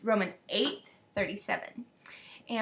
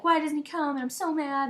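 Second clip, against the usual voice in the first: mock hysterical friend; 6 semitones higher, 3 dB louder than usual. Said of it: "she was just hysterical".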